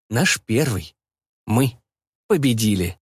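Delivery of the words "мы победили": usual speaking voice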